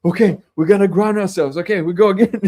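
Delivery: put-on rally everyone like an overjoyed kid; acting out breathless eagerness, on the edge of laughter; giddy little-kid excitement. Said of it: "like, this little kid".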